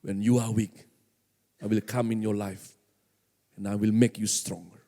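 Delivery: low, measured voice of God; weighty divine promise in a low register; God speaking. Acted. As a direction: deliver it deep and solemn, phrase by phrase. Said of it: "Bahkan Tuhan bilang".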